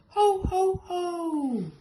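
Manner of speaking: Father Christmas laughing with relief; Santa laugh. A narrator's reading. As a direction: burst into jolly relieved laughter. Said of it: "he burst out laughing".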